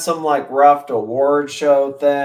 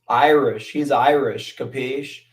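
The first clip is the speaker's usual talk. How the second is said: ordinary delivery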